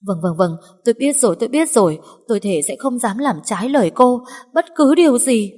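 high, loud anxious promising; frantic submission. Acted. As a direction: swear anything to stay alive. Maid swears obedience in fear.

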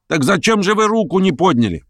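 high-pitched indignant question; indignant challenge. A narrator's reading. Hostess challenging the schoolboy's vote, indignant. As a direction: demand an explanation in a high voice.

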